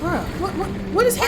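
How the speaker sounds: baffled. Real, not acted. Bike stuck, player baffled.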